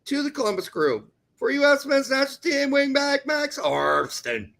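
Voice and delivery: loud, high, punchy news read; news bulletin read. Acted. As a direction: announce it like a breaking sports ticker. Reads transfer news bulletin.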